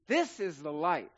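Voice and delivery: ordinary talk, nothing marked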